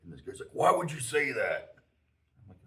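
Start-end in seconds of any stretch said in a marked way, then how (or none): 0.5-1.7 loud, high, drawn-out indignant retort; angry confrontation. Retold or imitated. Guy in the stall confronting the joker, indignant. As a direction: imitate an outraged man calling out.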